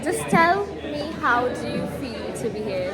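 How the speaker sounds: loud, high, fast excited greeting; bubbly shouted hello to camera. Real, not acted. Excited greeting at graduation vlog.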